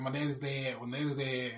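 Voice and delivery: usual speaking voice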